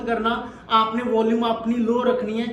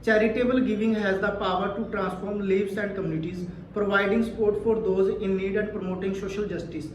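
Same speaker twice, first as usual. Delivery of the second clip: relaxed, slow, clear read aloud; 3 semitones lower, 5 dB quieter, much slower than usual. Shown as a demonstration. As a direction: read calmly, pausing at commas. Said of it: "बिल्कुल relax होकर के पढ़ा है".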